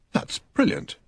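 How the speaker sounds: breathed out in a high whisper; a soft awed breath of a line. A narrator's reading. Standish, awed by the joke, breathes it out in a high whisper. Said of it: "Standish breathed".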